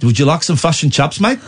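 clipped Kiwi vowels in a New Zealand accent. Shown as a demonstration. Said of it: "New Zealand".

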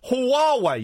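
Huawei stretched to 'ho-WAH-way', high; mimicked mispronunciation. Shown as a demonstration. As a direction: say Huawei slowly with two W sounds. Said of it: "you're saying Huawei".

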